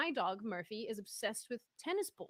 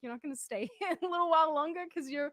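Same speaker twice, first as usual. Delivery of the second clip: higher, louder, laughing plea from parents; pleading parents; 8 semitones higher, 8 dB louder than usual. Retold or imitated. Parents begging her to stay so their kids can play, laughing plea. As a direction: beg them playfully to stay longer. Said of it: "I can see the parents like".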